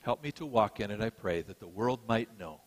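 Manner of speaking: gentle sermon-closing prayer. Acted. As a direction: pray humbly over the congregation.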